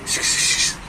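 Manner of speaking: whispered shoo sounds to herd chicks; shooing hiss. Real, not acted. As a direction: hiss them along, no voice. (fısıltıyla kışkışlayarak)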